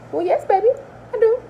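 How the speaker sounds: put-on high, sugary "yes, baby"; sweetly sarcastic, as if to a child; sassy mock-sweet. Mocking answer to sister's question.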